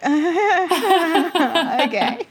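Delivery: high, laughing, drawn-out okay; nervous laughing okay. Retold or imitated. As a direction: agree while laughing at your own nerves.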